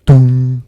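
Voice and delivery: loud, booming "dong" held on one note; clock chime. A narrator's reading. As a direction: imitate a striking clock, ringing out the hum. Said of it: "went the clock".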